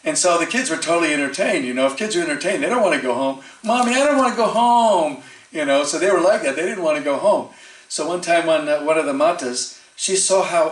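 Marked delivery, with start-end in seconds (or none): 3.6-5.2 put-on high pleading little-kid voice, a bit louder; whiny child. Child begging mommy to stay, high and whiny. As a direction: beg like a kid who won't leave the fun.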